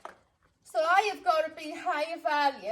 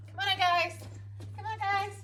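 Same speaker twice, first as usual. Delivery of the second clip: bright higher call to the dogs; 6 semitones higher than usual. Shown as a demonstration. Trainer calling her dogs in, a bright coaxing call. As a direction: coax the dogs in cheerily.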